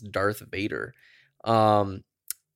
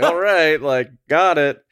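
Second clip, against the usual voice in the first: resigned; 6 semitones higher, 8 dB louder, slower than usual. Retold or imitated. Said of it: "I'm like".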